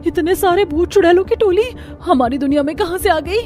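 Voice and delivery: frightened villager shrieking a warning; terrified screaming. Acted. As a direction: scream at everyone to run home. Villager sees ghost horde.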